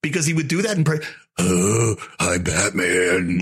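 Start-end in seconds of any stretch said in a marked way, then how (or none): 1.4-3.4 slow, low mock Batman growl; bad Batman voice. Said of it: "it was the worst Batman impression".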